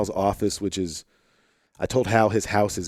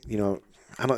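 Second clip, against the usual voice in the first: ordinary talk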